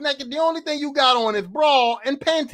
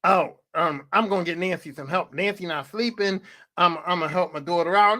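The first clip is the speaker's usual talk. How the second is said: lower, a mock mother's excuse; mock mom voice; 6 semitones lower than usual. Comedian mocks the mom's excuse, in a lower mock mom voice. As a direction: lightly put on, lower and defensive. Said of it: "But then you go".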